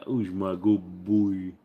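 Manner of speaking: slow gentle dog talk. Real, not acted.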